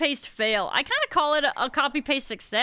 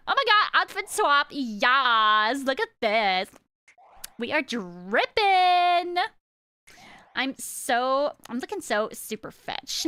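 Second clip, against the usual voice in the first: put-on valley girl. Shown as a demonstration. Streamer shows viewers the outfit swap, gushing valley girl. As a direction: play an over-dramatic valley girl, lingering on words. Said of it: "also do the Valley Girl".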